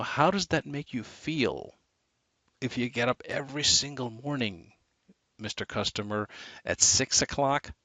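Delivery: inflect so the listener feels the problem; marked voice inflection. Shown as a demonstration. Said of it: "you notice the voice inflection".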